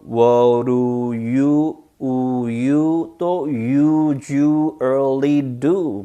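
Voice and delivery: very slow "What do you usually do", vowels drawn out; slow stretched demo. Shown as a demonstration. Teacher showing the many 우 sounds, very slow and stretched. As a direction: hold each "oo" in "you", "usually" and "do". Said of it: "천천히 많이 해놓으세요".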